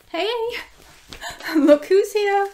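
light up to greet the dog; happy greeting. Real, not acted.

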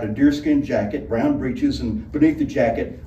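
ordinary delivery, nothing marked

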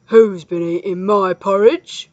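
loud, gruff bear growl; growling father bear. A narrator's reading. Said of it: "growled Father Bear".

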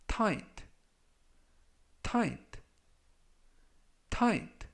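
tight said three times with the gh silent; silent gh demo. Shown as a demonstration. Teacher showing the silent gh in tight, slow and clear. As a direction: say tight as "tait", no g or h sound. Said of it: "지에이치가 이번 단어에서 묵음이라서".

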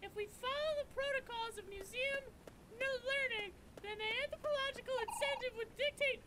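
high lecturing know-it-all voice; pompous scholar. Acted. Professor explaining the artifact site, high and pompous. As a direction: lecture it with stuffy pride.

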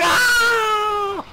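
high, loud scream of shock; sudden cry as he gets hit; pained scream. Real, not acted. Player screaming when grabbed by the monster.

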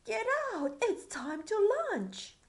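high loud royal command; bossy queen call. Acted. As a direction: order him out brightly. Queen calling the king.

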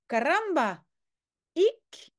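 caramba with a light Spanish flair; Spanish exclamation. Acted. Teacher voicing General Alcazar says caramba in Spanish accent. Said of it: "on essaie d'imiter l'accent espagnol du général".

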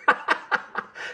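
high breathless giggle; a soft airy giggle at the prank. Real, not acted. Laughing at the trick.

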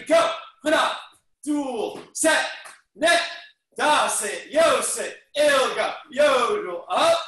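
loud rhythmic counting shouts; shouted count. Real, not acted. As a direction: call each count out loud. Counting jumping jacks aloud.

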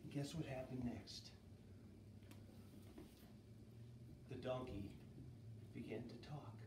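soft, low storyteller's hush; hushed suspense. Acted. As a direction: lean in and build suspense softly. Suspense reveal to children.

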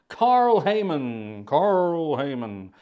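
slow, drawn-out names, loudly savoured; Carl Sagan. Acted. Livestream host playfully reading a viewer's name as Carl Sagan. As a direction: stretch each name out in grand impersonation. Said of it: "that was Carl Sagan, wasn't it".

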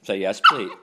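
firm loud prompt to the dog; loud coaching; loud command. Real, not acted. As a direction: call it out to get her to answer.

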